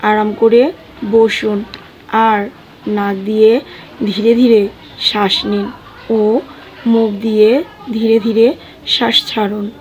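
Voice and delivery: slow meditation guide, short phrases with long pauses; guided meditation. Acted. Guide easing listeners into breath and calm, meditative delivery. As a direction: lead listeners gently through breathing, phrase by phrase.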